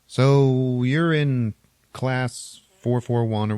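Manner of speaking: slow, put-on automated announcement voice; ship computer voice. Ship computer greeting the lone passenger, slow mechanical voice. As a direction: drag it out like a machine talking. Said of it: "the computer's like".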